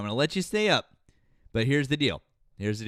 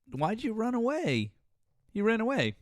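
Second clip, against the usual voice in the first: mocking taunt; 12 semitones higher than usual. Mocking a streamer who left.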